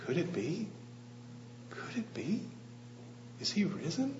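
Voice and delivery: high, hushed wondering; awed hush. Acted. Peter alone in the empty tomb, a high hushed wonder. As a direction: breathe it out in disbelief and wonder.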